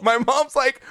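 ordinary delivery

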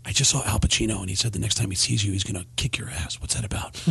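low, whispered warning, voice dropping away; hushed whisper. Retold or imitated. Baldwin whispering to the host what people warned him. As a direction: pass on a secret threat in a whisper. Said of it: "People are telling me".

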